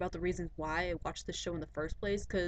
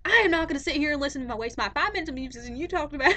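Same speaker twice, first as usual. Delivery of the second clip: higher, louder, fast mock Doctor Phil; imitating Doctor Phil; 8 semitones higher, 10 dB louder, faster than usual. Vlogger mimics Doctor Phil scolding a guest, mock Texan lecture. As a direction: rattle it off as a hammed-up Doctor Phil. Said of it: "Doctor Phil was like".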